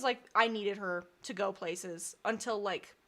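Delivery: usual speaking voice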